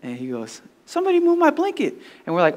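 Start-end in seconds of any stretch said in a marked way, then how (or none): 0.8-1.9 very high mock-upset cousin voice. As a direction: whine it high, imitating his complaint. Upset cousin's quote acted out.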